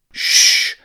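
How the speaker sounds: sharp whispered shh; hissed shush. Acted. Rat hushing the boy near the locals, a sharp hiss. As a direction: hush him fast, no voice. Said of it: "Zen hissed".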